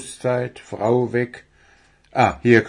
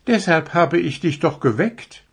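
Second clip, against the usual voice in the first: high, lively deer voice; young deer character; 8 semitones higher than usual. Acted. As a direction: play an eager little deer, pitched up.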